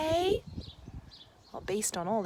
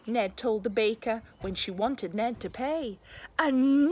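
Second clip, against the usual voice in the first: lively, expressive picture-book read-aloud; reading to children; 4 dB louder than usual. A narrator's reading. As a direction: read it like a bedtime story for a class.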